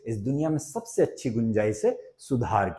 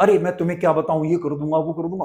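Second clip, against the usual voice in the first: raised, higher-pitched mock rant; mock shouting; 4 semitones higher, faster than usual. Shown as a demonstration. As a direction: imitate someone ranting, starting in a rush. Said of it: "चिल्ला चिल्ला".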